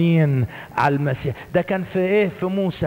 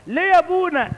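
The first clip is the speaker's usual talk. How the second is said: put-on much higher voice of a curious boy; child's voice; 12 semitones higher than usual. Boy asking the old priest why, child's voice. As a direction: ask like a young boy.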